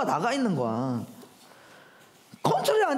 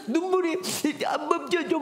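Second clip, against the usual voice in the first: put-on tearful child; 4 semitones higher than usual. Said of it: "막 이러면서".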